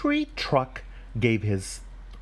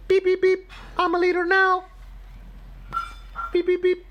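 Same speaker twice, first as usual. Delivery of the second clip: very high squeaky beeping truck; little truck. Acted. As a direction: beep proudly in a tiny voice. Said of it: "He's going".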